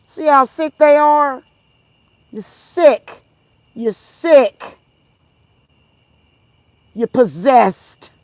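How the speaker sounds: loud, high angry call-out; angry denunciation. Real, not acted. Vlogger condemning chat commenters, loud and high angry. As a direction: shout it down at them.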